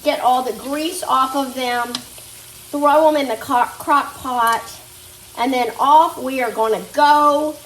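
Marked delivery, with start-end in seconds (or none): none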